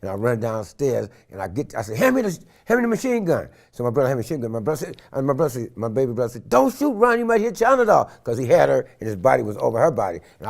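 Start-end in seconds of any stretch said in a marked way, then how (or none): 2.0-3.5 high, urgent order; frantic call for the gun; urgent demand. Retold or imitated. Father rushing downstairs demanding the gun, urgent. 6.5-8.1 high, urgent warning. Retold or imitated. Baby brother warning not to shoot the child, urgent.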